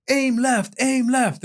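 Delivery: high-pitched urgent call; acted neighbor shouting orders; called-out instructions. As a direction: call out directions to someone at a hose. Neighbor calling out hose directions.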